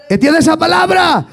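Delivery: high, shouted revival sermon; fervent Pentecostal shout; full-force preaching. Real, not acted. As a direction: preach it at the top of your register, long cries on line endings.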